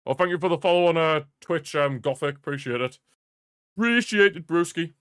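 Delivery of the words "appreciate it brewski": high, loud burst of gratitude; exuberant thanks. Real, not acted. Streamer thanks a new follower, exuberant loud thanks.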